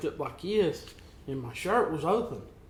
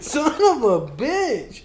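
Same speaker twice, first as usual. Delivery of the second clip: high, loud, slow, laughing it out; laughing exclamation; 8 semitones higher, 8 dB louder, much slower than usual. Retold or imitated. Reacting to coworker's sting, slow laughing curse. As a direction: stretch the curse through laughter.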